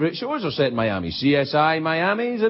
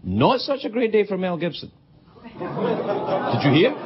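normal talking voice

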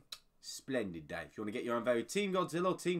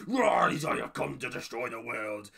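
mock monster voice; 3 semitones lower, 4 dB louder than usual. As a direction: announce doom like a movie monster. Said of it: "I thought he was going".

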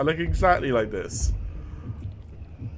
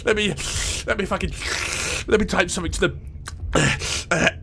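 congested, wheezy mocking voice, higher; mock forum poster; 5 semitones higher, faster than usual. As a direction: gasp between words like a breathless commenter. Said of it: "every single person who's gonna be like".

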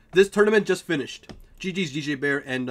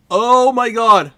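stunned; 5 semitones higher, 11 dB louder, much slower than usual. Real, not acted. Match ending, stunned reaction.

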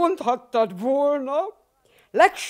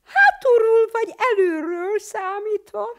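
higher, curious put-on voice; inquiring; 6 semitones higher than usual. Aromó asks back, curious and higher. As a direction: ask it like a clever know-all. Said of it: "érdeklődött Aromó".

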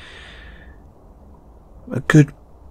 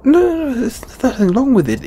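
high-pitched hasty reassurance; flustered backpedaling; 13 semitones higher, faster than usual. Acted. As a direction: voice shoots up as you backtrack. Hastily denies objection to film.

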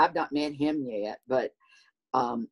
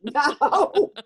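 much higher, emphatic correction through laughter; mock a friend's exasperation, pitched up; exasperated friend; 8 semitones higher, slower than usual. Friend correcting her misunderstanding about going viral, high exasperated cry. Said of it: "he said".

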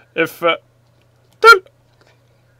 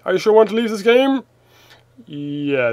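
ordinary talk

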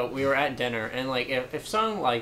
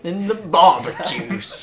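goofy silly voice; 6 semitones higher, 9 dB louder, slower than usual. Pretend.

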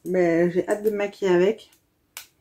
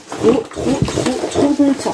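gushing delight; 5 semitones higher, 4 dB louder, slower than usual. Real, not acted. Gushing over the gift box.

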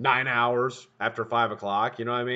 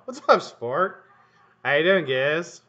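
higher, slow cheerful pet greeting; higher fond hello to the dog Giz; 5 semitones higher, 5 dB louder, slower than usual. Real, not acted.

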